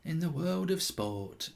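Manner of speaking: slow, hushed put-on voice; soft and drawn out, like a solemn voiceover; hushed, measured broadcaster impression; attempted impression. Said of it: "I can't do it".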